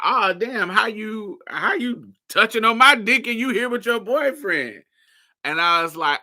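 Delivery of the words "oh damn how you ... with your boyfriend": high, louder disbelieving oh damn; shocked question. Retold or imitated. The older guy learns she has a boyfriend, mock shocked high. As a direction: play his outraged surprise. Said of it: "And he was like".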